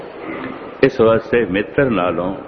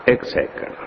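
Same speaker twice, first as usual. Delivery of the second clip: ਇੱਕ second whispered and softer; 5 dB quieter than usual. Shown as a demonstration. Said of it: "repeated for contrast".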